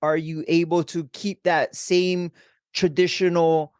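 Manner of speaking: ordinary talk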